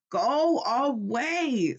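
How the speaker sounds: slow, drawn-out sleepy grumble; grumpy sleepy Toad. A narrator's reading. Sleepy Toad telling Frog to leave, slow grumble. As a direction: groan it from under the covers. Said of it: "said the voice from the corner".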